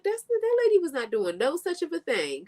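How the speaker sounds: high, indignant retort; high-pitched exasperated rebuttal; incredulous retort. Retold or imitated. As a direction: defend her, exasperated and high. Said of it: "I was like".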